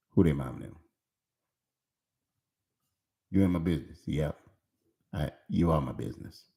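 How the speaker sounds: low, soft prying parent voice, all up in the business; dropped low and quiet like a meddling mom; nosy parent. Retold or imitated. Pastor voicing a nosy parent checking on grown kids, low and soft.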